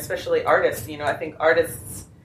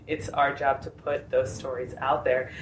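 usual speaking voice

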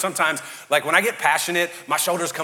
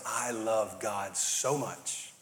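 softer, slow, lower voice; hushed and devoted, slow; 5 semitones lower, 9 dB quieter, much slower than usual. Retold or imitated. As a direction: say it like a quiet confession of love. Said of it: "live from a place of".

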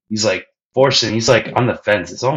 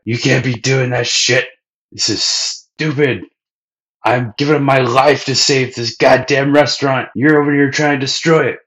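raised, higher exasperated rant; angry Sanji; 5 semitones higher, 3 dB louder than usual. Retold or imitated. Sanji scolding Luffy about saving the restaurant, angry. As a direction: rant at him, voice up. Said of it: "pretty clear right there".

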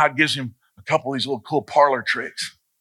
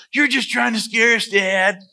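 higher, lightly voiced kid's protest; protesting child; 9 semitones higher than usual. Child to dad at the campfire, protesting. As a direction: pitch it up like a child accusing dad.

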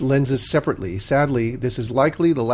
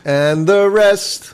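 slow, drawn-out grand sign-off, pitched up; grand announcer; 6 semitones higher, 5 dB louder, much slower than usual. Pretend. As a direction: stretch it out like a TV announcer.

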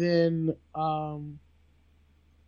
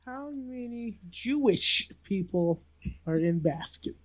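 slow, spaced-out question; voice-assistant query; 3 semitones higher, 3 dB quieter, slower than usual. Real, not acted. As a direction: spell it out slowly for a voice assistant. Dictating a question to Google.

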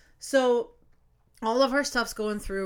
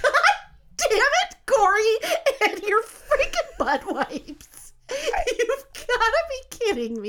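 much higher, louder, slow, laughing-crying damn it Corey; laughing exasperation; 12 semitones higher, 4 dB louder, slower than usual. Real, not acted. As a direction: rant at him while cracking up. Venting at husband Corey, laughing.

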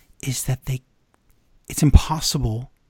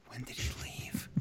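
whisper; 4 semitones lower, 21 dB quieter than usual. Real, not acted. Mock aside at the Miz quote.

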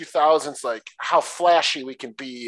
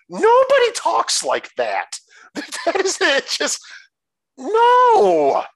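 high, loud, half-laughing protest; squeaky laughing no; laughing exasperation; 6 dB louder, slower than usual. Real, not acted. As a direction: crack up in disbelief at the dumb line.

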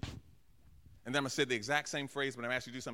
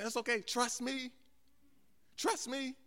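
gentle reassurance; 7 semitones higher, slower than usual. Acted. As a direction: reassure them lightly and tenderly. God's reassurance voiced after outburst.

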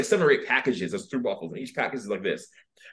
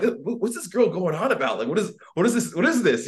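puzzled; 7 semitones higher than usual. Retold or imitated. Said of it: "I'm like".

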